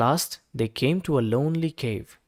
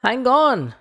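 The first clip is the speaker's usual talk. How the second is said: much higher, loud quacky call; quacking duck; 9 semitones higher, 8 dB louder than usual. A narrator's reading. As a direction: squawk it like a startled duck. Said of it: "quacked the duck".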